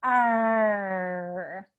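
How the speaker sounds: long held "er", sliding down; drawn-out R sound. Shown as a demonstration. Coach showing the R sound, drawn-out "er". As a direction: sustain "er" with open jaw and tongue pulled back. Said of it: "makes you make the R sound".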